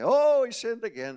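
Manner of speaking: mock alarm. Mock alarm in imitated doubter.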